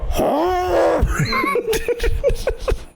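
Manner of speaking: long high shouted cry; loud yelp. Retold or imitated. Reenacting gagging in the chair, a long high yell. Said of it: "I go".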